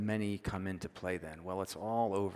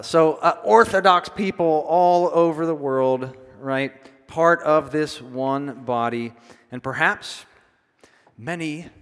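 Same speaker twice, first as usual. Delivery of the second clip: much louder, driving, slowed on "Part of this one body"; full-force preaching; 4 semitones higher, 11 dB louder than usual. Acted. Preacher rousing the congregation about one body, loudly. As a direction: swell up and proclaim to the room.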